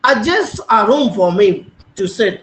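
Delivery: very slow, word by word; slow teacher read; slow model sentence. Shown as a demonstration. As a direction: dictate the example slowly. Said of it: "adjust".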